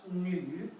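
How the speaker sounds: "nu" dipped down then up, slowly; slow third-tone "nu", falling then rising. Shown as a demonstration. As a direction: let "nu" dip and come back up, drawn out. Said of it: "the tone goes down and up".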